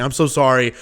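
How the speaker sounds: normal talking voice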